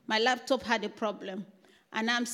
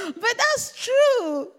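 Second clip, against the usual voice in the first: laughing; 11 semitones higher, 5 dB louder, much slower than usual. Real, not acted. Laughing at own dance joke.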